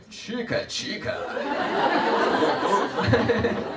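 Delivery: cowboy-style chant; playful cowboy impression. Shown as a demonstration. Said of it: "Say it like a cowboy".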